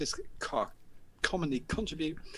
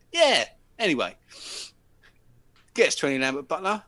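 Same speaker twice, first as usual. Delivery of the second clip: louder, brushing it off; pushy lad; 3 semitones higher, 7 dB louder than usual. Retold or imitated. Lad asking the narrator to buy cigarettes, louder and brushing it off.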